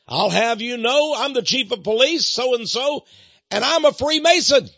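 loud, high-pitched indignant outburst; irate police chief. Retold or imitated. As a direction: thunder it like an offended official. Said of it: "He was angry".

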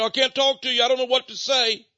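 high-pitched mock protest of being tongue-tied; mock helplessness. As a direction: put on a high, exaggerated helpless voice. Mocking someone tongue-tied with a friend.

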